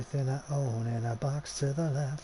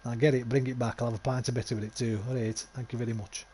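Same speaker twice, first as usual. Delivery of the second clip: broad northern Yorkshire bloke ordering breakfast, loud; a gruff Yorkshireman in a pub demanding his barm cake; Yorkshire lad shouting his breakfast order in a pub; Yorkshire accent; 4 dB louder than usual. Pretend. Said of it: "Something like that".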